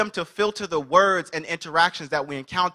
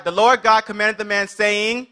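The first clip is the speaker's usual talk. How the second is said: loud, raised-pitch scripture proclamation; best God voice; 5 semitones higher, 5 dB louder than usual. Acted. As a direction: lead the room reading as God. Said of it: "read it in your best God voice".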